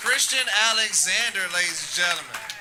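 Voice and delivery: hyping up the crowd; big host introduction, name punched; announcer. Acted.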